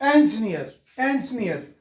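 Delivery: इंजीनियर said en-jnir, clipped middle; correct pronunciation. Shown as a demonstration. Teacher modelling correct English. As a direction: run इंजीनियर together as en-jneer. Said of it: "नहीं है बल्कि".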